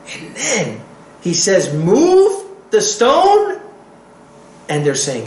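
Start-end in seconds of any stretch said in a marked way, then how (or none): 1.7-3.6 high, slow, loud command; commanding order. Acted. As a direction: call it out with full authority. Yeshua's command at the tomb.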